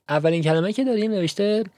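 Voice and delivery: ordinary delivery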